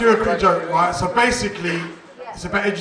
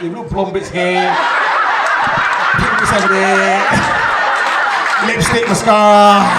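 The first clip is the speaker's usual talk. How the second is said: loud, rising comic rant; mock exasperation; 3 semitones higher, 10 dB louder than usual. Comic listing his makeover to the audience, loud mock exasperation. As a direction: build louder with each item, mock outraged.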